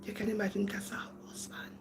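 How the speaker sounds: hushed, scandalised whispering; mock onlookers' whispered aside; gossiping whisper. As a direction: whisper it behind her back. Acted onlookers gossip behind her back.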